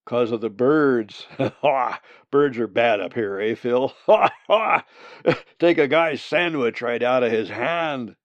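loud, laughing hunter; hearty guffawing. Acted. Hunter jokes to Phil about birds, guffawing. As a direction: joke loudly between big haws.

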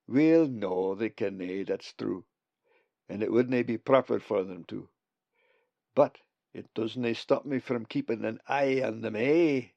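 broad friendly Scots voice; scottish accent. Acted. Bugs the raven speaks.

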